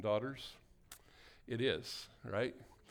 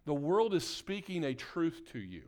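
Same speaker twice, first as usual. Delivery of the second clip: higher, insistent sermon delivery; emphatic preaching; 5 semitones higher, 4 dB louder than usual. Real, not acted. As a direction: preach it with mounting urgency. Preaches with rising intensity.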